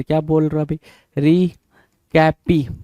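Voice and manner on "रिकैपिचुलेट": रिकैपिचुलेट broken into slow, separate chunks; syllable by syllable. Shown as a demonstration. Teacher drilling a new word for students, syllable by syllable. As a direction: spell out रिकैपिचुलेट by ear, pausing between parts.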